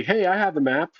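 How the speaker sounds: mock attempt at drawing attention; character's taunting call. Player voices his hero distracting the villain, lightly acted call. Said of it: "the stupidest thing would be".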